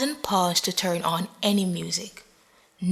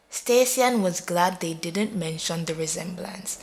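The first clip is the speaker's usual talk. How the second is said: usual speaking voice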